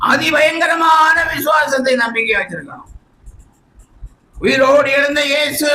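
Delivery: high, declaimed confession of faith; impassioned preaching. Acted. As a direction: lift each phrase high and hold it. Preaches at raised pitch.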